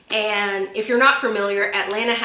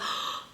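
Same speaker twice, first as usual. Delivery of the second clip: mock movie-crowd gasp; dramatic gasp; 11 dB quieter than usual. Imitating a movie crowd turning round, dramatic gasp. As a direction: gasp like an extra turning to stare. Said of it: "dramatic sound and then everybody turns".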